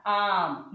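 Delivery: आम with a long, wide-open आ; open-mouthed aa. Shown as a demonstration. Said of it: "मुंह खोल के बोलो".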